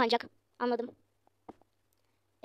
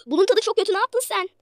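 angry customer; 7 semitones higher, 7 dB louder than usual. Acted. Customer complains about food.

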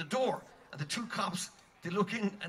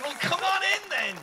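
cheerful invitation; 10 semitones higher, 5 dB louder than usual. Retold or imitated. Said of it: "So we said".

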